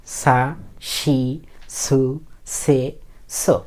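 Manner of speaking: slow, evenly spaced kana syllables; teacher chanting out the s-row; model recitation. Shown as a demonstration. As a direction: hold each of সা, শি, সু, সে, সো long, with a gap between. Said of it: "এভাবে পড়বেন".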